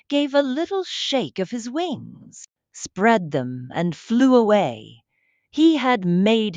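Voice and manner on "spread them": usual speaking voice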